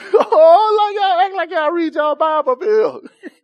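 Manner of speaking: high, loud laughing exclamation. Real, not acted. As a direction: laugh at the church's boldness.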